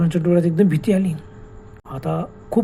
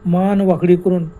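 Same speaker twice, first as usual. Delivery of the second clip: plain reading voice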